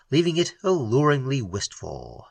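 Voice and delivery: usual speaking voice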